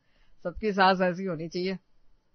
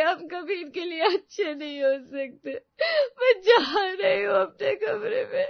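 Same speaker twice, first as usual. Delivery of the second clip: high-pitched tearful outburst; crying; 11 semitones higher, 5 dB louder than usual. Acted. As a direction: wail the complaint through sobs, high and loud. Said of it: "रुला दिया ना बेचारी को". (रोते हुए ऊँची आवाज़ में शिकायत)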